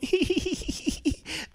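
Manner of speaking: high, soft flattered giggle; mock girlish giggle. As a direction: giggle like a woman charmed by a compliment. Imitating woman's flattered giggle.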